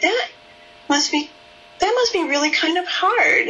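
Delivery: high, wondering and affectionate musing; curious wondering tone. Shown as a demonstration. Therapist wondering aloud to a girl, curious and tender. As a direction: think aloud as if a realization is dawning on you. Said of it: "affectively very rich, um, curious tone of voice".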